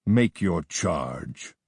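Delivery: low, slow kingly command; lightly voiced grim king; a hint of a sullen king giving an order; stern king. A narrator's reading. King Ptolemy demands the charge.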